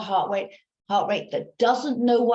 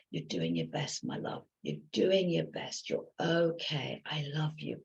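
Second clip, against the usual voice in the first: soft, tender reassurance; comforting self-talk; 4 semitones lower, 7 dB quieter than usual. Shown as a demonstration. Coach shows self-comfort, soft and tender. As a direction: soothe yourself like a loved one. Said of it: "I want you to start to comfort yourself".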